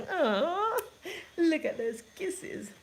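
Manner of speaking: high laughing coo. Real, not acted. Baby just gave kisses.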